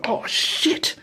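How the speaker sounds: whispered shock. Retold or imitated. Realizes he has Troy's license.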